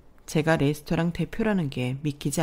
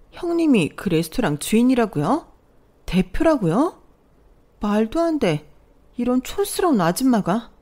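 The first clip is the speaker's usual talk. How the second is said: higher, stunned; shocked disbelief; 5 semitones higher, 4 dB louder than usual. Acted. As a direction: gasp it out in disbelief. Sister-in-law shocked, disbelieving. (믿기지 않는다는 듯 놀라서)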